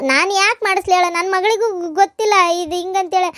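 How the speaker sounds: normal talking voice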